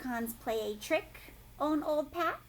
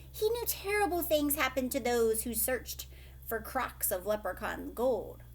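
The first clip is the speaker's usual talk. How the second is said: ordinary delivery, nothing marked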